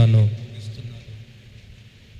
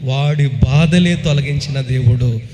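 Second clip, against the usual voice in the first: full-force preaching; 5 dB louder than usual. Acted. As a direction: pronounce the blessing with full power.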